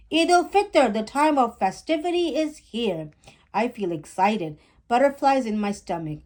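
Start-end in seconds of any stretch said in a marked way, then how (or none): 0.1-3.1 booming, exaggerated announcer voice. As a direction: announce it like a TV presenter. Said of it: "He is exaggerating his voice and sounds like a T V announcer".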